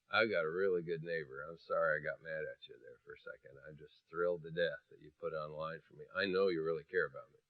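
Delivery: hushed, sincere gratitude to the neighbor. Acted. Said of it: "Then he thinks".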